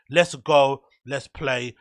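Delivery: ordinary talk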